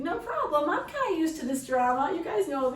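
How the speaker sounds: high, loud brush-off; breezy unconcern. Acted. Mother used to hospital drama tells herself no problem, breezily. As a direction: wave the crisis away loudly, pitched well up. Acting out her unworried thoughts.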